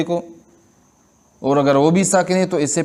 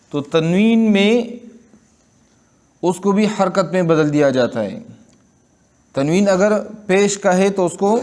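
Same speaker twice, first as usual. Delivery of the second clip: usual speaking voice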